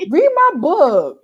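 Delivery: drawn-out, loud, high plea; exasperated plea. Retold or imitated. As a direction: stretch it into a pleading demand. Emphatic plea to imagined readers.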